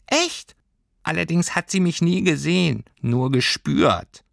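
very high, tiny creature voice; a small sprite confiding; high little Heimweh voice. A narrator's reading. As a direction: homesickness creature confirms it to Lilly. Heimweh answers Lilly.

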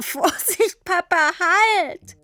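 put-on high, laughing squeal of a child; a girl being pulled along, squealing stop; squealing alarm. A narrator's reading. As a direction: quietschend rufen.

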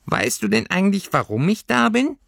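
high little creature asking; high creature voice, inquisitive; curious creature. Acted. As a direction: fur creature wondering. Heimweh voice.

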